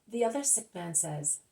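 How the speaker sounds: usual speaking voice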